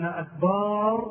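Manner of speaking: "أكبر" said slowly as akbaar, with a long aa after the baa; akbaar, long vowel. Shown as a demonstration. Teacher explaining the wrong word, "أكبر" stretched into akbaar. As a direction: lengthen the last vowel of "أكبر". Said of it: "جمع كبر وهو الطبل".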